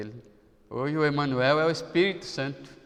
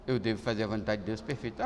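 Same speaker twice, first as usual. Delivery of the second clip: normal talking voice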